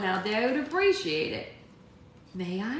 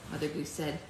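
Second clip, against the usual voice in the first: plain reading voice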